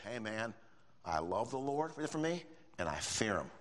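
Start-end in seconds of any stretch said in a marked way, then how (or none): none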